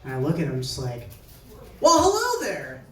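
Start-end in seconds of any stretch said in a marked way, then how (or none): 1.8-2.8 high, drawn-out mock greeting; put-on greeting voice. Comic answering a stranger's namaste, exaggerated put-on greeting. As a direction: stretch out an exaggerated, loud hello.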